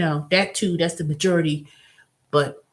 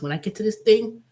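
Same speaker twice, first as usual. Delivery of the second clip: ordinary delivery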